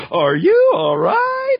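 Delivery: very high, loud, drawn-out cartoon question; squeaky performed line. Actor re-doing a studio line for hosts, high drawn-out squeal. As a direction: sing it out high and slow.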